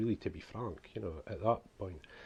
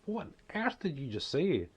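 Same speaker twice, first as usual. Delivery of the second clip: higher-pitched mock puzzled listener; imitated bafflement; 7 semitones higher than usual. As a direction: act out someone baffled by what they heard. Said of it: "people just says".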